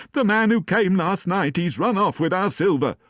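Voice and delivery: rising to a high, loud panicked cry. A narrator's reading. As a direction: wail that the silver is gone. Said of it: "she cried".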